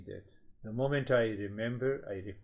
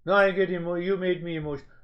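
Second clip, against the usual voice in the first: higher, louder mocking whine; mock complaint; 7 semitones higher, 7 dB louder, much faster than usual. Teacher imitating someone blaming others for emotions, mock whine.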